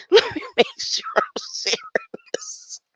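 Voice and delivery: squealing high laugh through words. Real, not acted. Checking the screen share, laughing and high.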